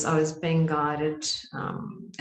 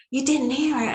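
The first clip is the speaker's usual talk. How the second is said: high, louder disbelief; astonished; 9 semitones higher, 5 dB louder than usual. Retold or imitated. Group members asking the leader, astonished. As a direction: press the question, amazed.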